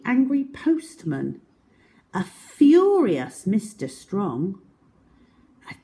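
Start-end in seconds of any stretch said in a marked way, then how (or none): none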